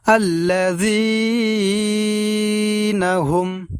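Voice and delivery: slow, chanted tilawat of the verse; Quran recitation. Shown as a demonstration. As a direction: recite it with measured melody.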